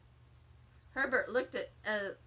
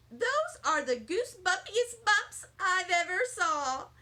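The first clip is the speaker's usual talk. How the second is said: gushing; 5 semitones higher, 3 dB louder than usual. Acted. Lucille gushing at Sheldon.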